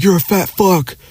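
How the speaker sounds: high, sneering schoolyard jeer; mocking taunt. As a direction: sneer it like a bratty kid. Kid mocking speaker while pointing.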